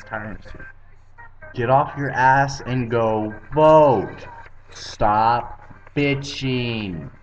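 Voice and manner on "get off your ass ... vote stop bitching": loud, drawn-out command; emphatic exhortation. Real, not acted. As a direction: hammer each word slowly and loudly. Exhorting viewers to vote, fed up.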